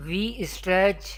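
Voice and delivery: ordinary talk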